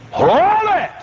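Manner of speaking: high-pitched stretched shout; hollered halt. Retold or imitated. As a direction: holler it high to freeze him. Second, hollered command.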